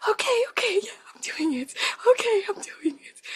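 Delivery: high voice slipping into a whisper and a sob; high tearful panting. Retold or imitated. Mother in labour telling the midwife she is doing it, high tearful voice. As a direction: gasp out agreement, close to tears.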